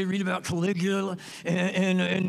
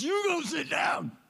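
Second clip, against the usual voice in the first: insistent shout; 8 semitones higher than usual. Retold or imitated. Retold insistent offer, dishes.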